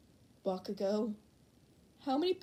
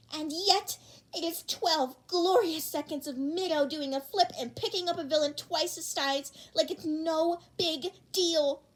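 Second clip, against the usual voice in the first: higher, louder swooning rave; gushing fan; 7 semitones higher, 6 dB louder than usual. Acted. Fan defending the video to Bakugo, high gushing voice. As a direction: rave about the clip dreamily.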